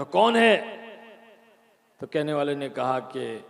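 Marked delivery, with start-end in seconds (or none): 0.0-0.6 higher, louder call up to the roof; shouted question. Retold or imitated. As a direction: call out into the dark. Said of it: "चिल्ला करके पूछा". (छत की तरफ़ ज़ोर से पुकार)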